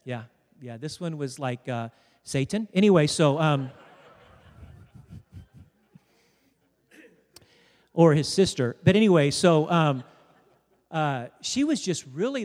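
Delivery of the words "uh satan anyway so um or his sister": usual speaking voice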